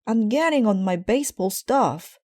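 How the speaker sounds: put-on high, loud kid voice; boy yelling. A narrator's reading. Brother yelling to Mama while dressing. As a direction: shout it from upstairs.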